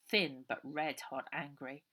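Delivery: ordinary delivery, nothing marked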